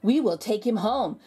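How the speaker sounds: ordinary delivery, nothing marked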